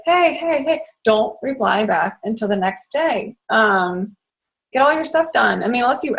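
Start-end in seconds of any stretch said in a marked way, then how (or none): 0.1-0.8 higher-pitched pestering hey-hey-hey; mock eager greeting. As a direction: imitate people popping up for attention. People messaging, acted out.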